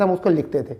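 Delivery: ordinary talk, nothing marked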